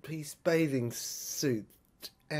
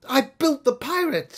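proud woman; 7 semitones higher, 11 dB louder than usual. Acted. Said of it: "she said".

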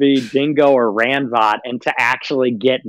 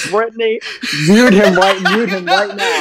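bullying order; 7 semitones higher than usual. Retold or imitated. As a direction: re-enact hectoring someone, half laughing. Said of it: "just bullied Brittany into muting".

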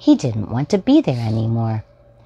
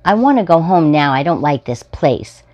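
put-on louder, faster childish whine; upset child; 5 semitones higher, 6 dB louder than usual. Boy in the grocery line demanding to go home, loud and whiny. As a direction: stamp your foot like a cranky kid.

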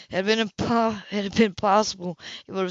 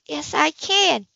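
insistent; 7 semitones higher, 4 dB louder, much slower than usual. A narrator's reading. Insists it was her fault.

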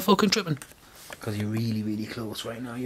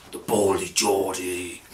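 low, slow villain voice; mock devilish deep voice; 9 semitones lower, slower than usual. Shown as a demonstration. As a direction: growl it out like a demon announcer. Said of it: "this devilish, like, deep voice".

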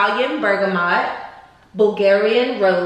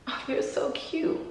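hushed delight; 5 semitones higher, 9 dB quieter than usual. Real, not acted. Putting earrings in, hushed.

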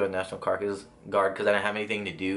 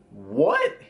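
shocked what; 4 dB louder than usual. Retold or imitated. Told of a warrant, shocked.

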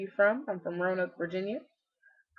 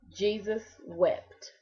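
solemn reading; 4 dB quieter, much slower than usual. Acted. Reading a Bible passage.

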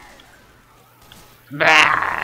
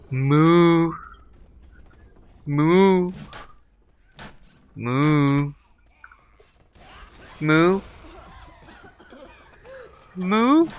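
cow moos, rising higher; mock moo; 6 semitones higher, much slower than usual. Gamer mocks the zombies he calls cows with a moo.

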